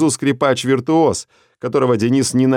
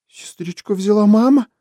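put-on much higher, a scared small girl's voice; frightened little girl; 11 semitones higher than usual. Little Lena asks her father, scared, in a much higher voice. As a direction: ask like a startled child. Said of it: "испугалась Леночка".

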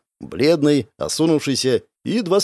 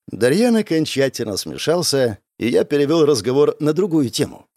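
ordinary delivery, nothing marked

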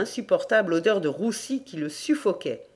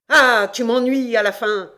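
loud, raised-pitch impatience; exasperated shout; 4 semitones higher, 7 dB louder than usual. Acted. Damned landlord fed up with the farmer, exasperated shout. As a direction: lose patience out loud.

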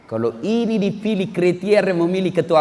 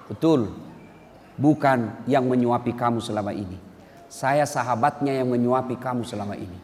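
lower, softer, gentle confession; soft reply; 7 semitones lower, 6 dB quieter than usual. Acted. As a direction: answer him tenderly and quietly. Abu Bakar gently answers blind man. (Abu Bakar menjawab pelan dan lembut)

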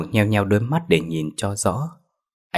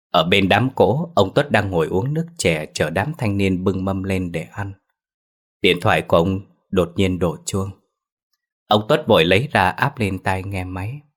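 usual speaking voice

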